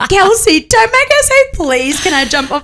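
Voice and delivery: high, loud playful objection; mock protest. Friend objecting to strict mum, high hammed-up protest.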